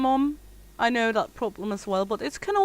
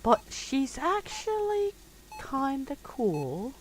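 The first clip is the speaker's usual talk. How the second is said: soft dreamy voice; 2 semitones higher, 7 dB quieter, slower than usual. Retold or imitated. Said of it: "And the other is".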